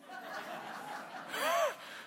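a high, breathy burst of real laughter. Real, not acted. Pastor laughing at a congregation member's joke. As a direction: laugh along at the nasty doctor joke.

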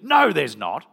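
loud, dismissive mock call; shouted denial. Surfing wife refusing to believe her husband, shouted denial. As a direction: holler back a firm refusal.

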